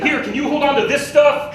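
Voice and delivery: loud, high-pitched comic yell; hammed-up shouted request. Every virgin in Phoenix dumping stuff on him, mock shouting. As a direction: shout it as an exaggerated plea. Acted quote as comic exaggeration.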